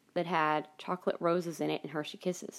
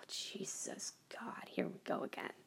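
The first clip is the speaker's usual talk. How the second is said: whispered, slow and exasperated; 4 semitones lower, 16 dB quieter, slower than usual. Retold or imitated. Fed up with mom's reply, whispered exasperation. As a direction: breathe it out under your breath. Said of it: "I'm like".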